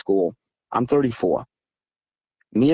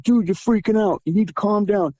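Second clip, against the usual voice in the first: high-pitched telling-off; acting out a friend urging calm, pitched up; retold urgent pleading; 9 semitones higher than usual. Friend's side of acted quarrel.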